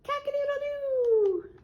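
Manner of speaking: high crow with a falling tail; piercingly high rooster call. Acted. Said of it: "Can you make that noise?".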